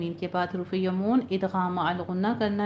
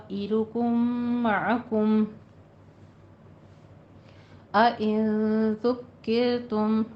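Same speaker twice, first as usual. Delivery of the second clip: Quranic recitation. Shown as a demonstration. Teacher recites the full verse for the class, chanted. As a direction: recite it melodically, drawing out the madd. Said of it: "teacher recites verse segment".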